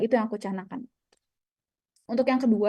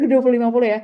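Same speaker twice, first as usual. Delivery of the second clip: laughing through the words, loud; laughing repeat; 8 dB louder, much faster than usual. Real, not acted. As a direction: repeat it while laughing at yourself. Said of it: "repeats laughing". (mengulang sambil tertawa)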